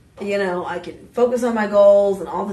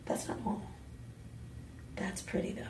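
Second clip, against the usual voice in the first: whispered aside; 18 dB quieter, faster than usual. Real, not acted. Quiet aside about chains.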